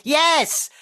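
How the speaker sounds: very high-pitched exclamation; excitable woman caller. Retold or imitated. Woman caller confirming, high excitable mock voice. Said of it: "I actually had a woman call me on the phone".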